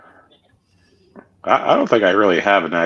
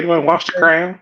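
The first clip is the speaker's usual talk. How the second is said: high-pitched mock British question; put-on British accent; 8 semitones higher than usual. American teasing a British friend about The Crown, mock British accent. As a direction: tease with a posh-TV British voice.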